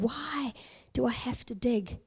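soft, mildly puzzled retold complaint; bewildered disappointment. Digger questions his Father's order, mildly bewildered. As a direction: question it quietly, a touch let down. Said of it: "almost a bit bewildered".

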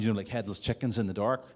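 ordinary talk, nothing marked

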